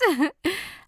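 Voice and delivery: short high giggle; a happy little laugh. Acted. Nicola laughing at her friend, giggle. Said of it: "lachte Nicola".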